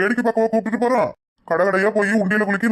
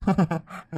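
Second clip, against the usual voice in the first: sheepish laugh; 5 dB quieter than usual. Acted. Caught out, laughs at himself.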